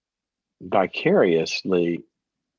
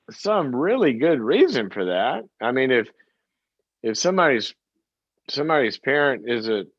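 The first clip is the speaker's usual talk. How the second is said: normal talking voice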